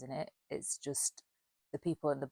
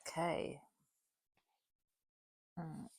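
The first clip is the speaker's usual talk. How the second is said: ordinary delivery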